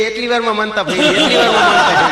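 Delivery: high excited yell building to a shout; husband yelling. Retold or imitated. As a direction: shout it out with glee as she falls. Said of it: "પતિદેવ રાડું પાડવા માંડ્યા". (ખુશીથી બૂમો પાડતો પતિ)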